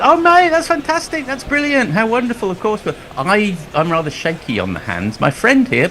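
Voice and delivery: very high-pitched mock delight; gushing enthusiasm. Creative director gushes to man about to propose, mock delight. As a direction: gush in an over-the-top high voice, imitating.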